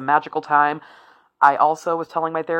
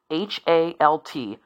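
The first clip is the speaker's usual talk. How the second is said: H A L T spelled letter by letter, slowly; slower than usual. Shown as a demonstration. Telling listeners her therapist's tip, slow spelled letters.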